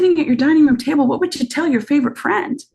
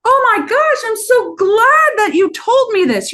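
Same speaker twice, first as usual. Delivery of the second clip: much higher, gushing and delighted; high, thrilled oh my gosh; excited gush; 10 semitones higher than usual. Shown as a demonstration. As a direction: gush like you can't wait to help. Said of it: "this is your moment to help them".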